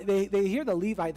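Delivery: ordinary talk, nothing marked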